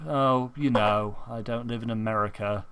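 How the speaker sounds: a touch of put-on self-pity; understated sad friend on the phone; mopey Lightning Rabbit. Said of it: "that is how Lightning Rabbit talks all the time".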